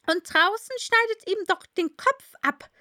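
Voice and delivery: high, loud commanding queen. A narrator's reading. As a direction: bark it like a haughty queen. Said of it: "fügte die Königin".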